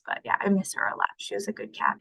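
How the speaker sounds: real quiet grief; soft sad. Real, not acted. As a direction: let it fade to a breath. Missing her late cat.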